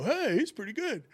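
lightly voiced heckler, surprised; mock fan, impressed. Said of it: "I hear one guy go".

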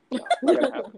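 high, laughing no way; laughing refusal. Retold or imitated. Host laughing off a ghost game idea. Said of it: "and I was just like".

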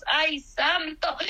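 ordinary talk, nothing marked

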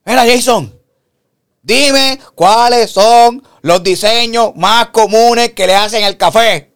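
high, slow, probing question; suspicious friend on speaker. Retold or imitated. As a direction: drag it out like a loaded trap. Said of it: "Estaba en speaker con Adi".

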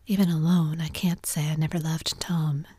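plain reading voice

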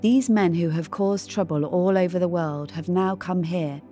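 ordinary delivery

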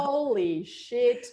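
slow, awed disbelief; stunned. Retold or imitated. Reliving the moment the spirit voice spoke, slow and stunned. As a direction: let it fall out slowly in awe. Shocked reaction to the voice.

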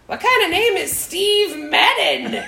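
giggly imitation of kids hollering in a shoe store; kids yelling playfully. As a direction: ham up the kids' rowdy teasing. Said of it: "yelling and having a huge fun time".

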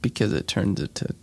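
hushed, unvoiced asides; whispering. Real, not acted. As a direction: whisper it under your breath. Hushed chemistry explanation.

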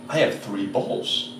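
quietly dumbstruck, drawn out; stunned realization. Retold or imitated. Boy discovering something in the mirror, slow hushed shock.

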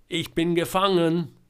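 loud, high call of a captive; calling out in distress. A narrator's reading. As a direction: call it across the woods. Trapped Georg calls out. (laut, hoch, verzweifelt rufen)

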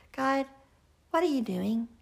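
asking God with open curiosity; wondering aloud, gently puzzled; curious question. Shown as a demonstration. Preacher models a curious prayer to God. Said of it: "That curious spirit instead of".

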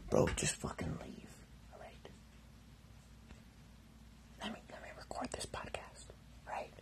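urgent hushed whisper. Acted. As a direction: whisper so the roommate won't hear. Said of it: "Why are you whispering".

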